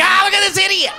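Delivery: high laughing jeer at an idiot; laughing mock heckle. Mocking onlooker in stand-up bit.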